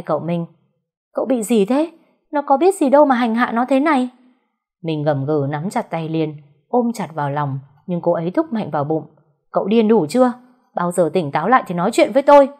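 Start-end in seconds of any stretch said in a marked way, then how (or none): 1.1-4.1 high, louder scolding; indignant protest. Acted. Liên confronts the raging husband. 9.5-12.5 loud, then fast and cutting; sharp rebuke. Acted. Liên fights back at Minh.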